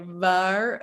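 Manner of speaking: waar with big bunny-teeth v, slow; exaggerated v. Shown as a demonstration. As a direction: overdo the lip bite on waar. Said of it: "you don't have to look like a bunny".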